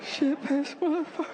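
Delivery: put-on very high little-child voice; kid parroting curses. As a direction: squeak it out like a small child.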